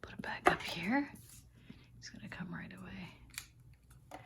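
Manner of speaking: soft unvoiced aside; whisper. Real, not acted. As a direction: whisper it so he won't hear.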